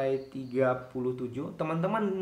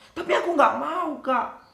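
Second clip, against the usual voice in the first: put-on high, loud childish no; a high whiny refusal to the teacher; stubborn refusal; 9 semitones higher, 4 dB louder than usual. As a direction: dig in like a stubborn kid.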